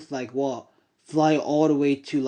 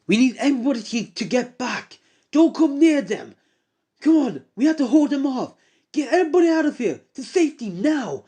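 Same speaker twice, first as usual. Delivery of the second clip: urgent shouted orders; 9 semitones higher than usual. Acted. Hero orders everyone to safety, high urgent shouting. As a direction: yell it like a hero holding the line. Heroes ordering crowd to flee.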